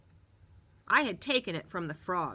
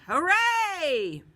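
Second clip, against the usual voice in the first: high, loud cheer; cheering shout; 11 semitones higher, 10 dB louder than usual. A narrator's reading. Bus riders cheer as the bully leaves. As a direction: cheer with the crowd.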